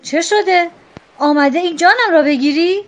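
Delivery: loud, high furious roar; roaring captain. A narrator's reading. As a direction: bellow it at the intruder. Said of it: "غرید".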